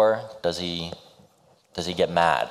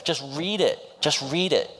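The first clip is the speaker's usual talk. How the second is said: high insistent plea; pleading hero voiced; 11 semitones higher than usual. Hero urging the woman to read his letter, high pleading. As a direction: press the letter on her, pitched high. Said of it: "He goes".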